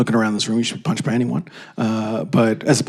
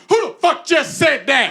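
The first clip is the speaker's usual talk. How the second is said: much higher, indignant call down the hall; 12 semitones higher than usual. Retold or imitated. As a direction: shout from a doorway, offended. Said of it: "stuck his head out".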